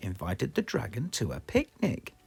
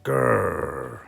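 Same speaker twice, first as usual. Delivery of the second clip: louder mock growl; dragon growl; 2 semitones lower, 6 dB louder than usual. A narrator's reading. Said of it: "said the dragon".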